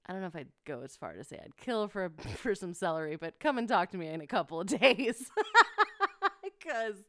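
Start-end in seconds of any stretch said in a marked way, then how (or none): 5.3-6.6 high burst of laughter. Real, not acted. Burst of laughter mid-story.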